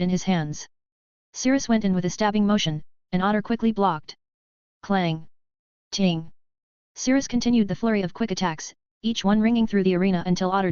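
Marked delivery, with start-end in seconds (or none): 4.8-6.3 low, short falling sword clangs; spoken clang and ting. Acted. Narrator voicing the duel's clashing blades as low clangs. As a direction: ring each one out like steel striking steel.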